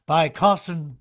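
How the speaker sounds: booming announcer read; imitating PA announcer. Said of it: "'Cause he would go".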